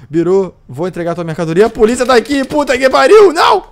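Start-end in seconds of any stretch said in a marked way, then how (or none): 1.7-3.7 high, loud panicked cry. Acted. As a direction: panic as the police arrive. Police arrive at auction.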